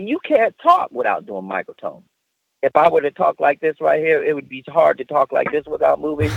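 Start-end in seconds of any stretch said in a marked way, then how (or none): 2.6-6.3 monotone. Shown as a demonstration. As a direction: talk on one single note. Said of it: "talk in one note".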